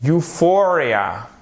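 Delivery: Euphoria as you-FOR-ia, drawn out; stress on F syllable. Shown as a demonstration. Teacher modelling the word for learners, stress on F syllable. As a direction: hit FOR in Euphoria, slowly. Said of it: "un accent tonique sur le F".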